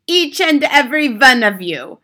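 loud, high thundering order. Acted. Grand High Witch thundering orders at the witches, loud. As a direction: boom the order at them. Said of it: "thundered the Grand High Witch".

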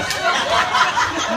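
airy, unvoiced laugh; breathy laugh. Real, not acted. Preacher laughing at his own story's punchline for the congregation, airy breathless laugh. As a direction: laugh under your breath, amazed.

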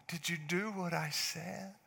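soft, slow, pointed; quiet pointed question. Retold or imitated. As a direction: let the question sink in. Quiet stern repeat.

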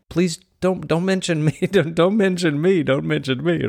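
high, laughing mock plea from Job; pleading Job. Job begging God not to name him, comic high plea. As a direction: beg to be left out, half laughing. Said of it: "I can imagine Job is saying".

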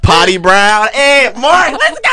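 high-pitched celebratory shout, climbing at the end; excited hype yell. Real, not acted. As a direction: whoop it up over a new nickname. Celebrating the new nickname.

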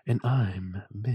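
slow sultry whisper; trembling whisper. Acted. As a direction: draw it out in a breathy whisper. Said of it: "Ooh, ooh, all right".

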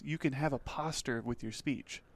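hushed reading with "poster" said as "POSS-ter"; misreading aloud. Retold or imitated. Rodeo student reading syllabus to classmate, "poster" misread as "POSS-ter". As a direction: read it softly, "poster" getting a short "ah" vowel.

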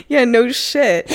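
high, slow, laughing through it; laughing mock retort. Host guessing her co-host's sarcastic reply, laughing. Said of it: "you're gonna be like".

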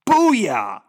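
a gleeful victory whoop on Booyah; triumphant booyah. Real, not acted. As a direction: cheer Booyah like a winning shout. Triumphant cheer at Christ's words.

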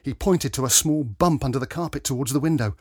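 plain reading voice

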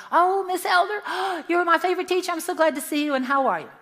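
high, loud, gasping delight; a former student gushing over her favorite teacher. Retold or imitated. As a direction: gush like a thrilled ex-student meeting her old teacher.

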